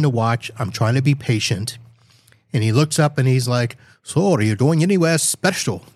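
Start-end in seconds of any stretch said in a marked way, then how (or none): 4.0-5.8 lightly put-on Spanish accent, pitched higher; higher, half-hearted Spanish-accented impression; accidental Spanish accent. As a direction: imitate an agent with a mistaken Spanish lilt. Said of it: "I sounded Spanish".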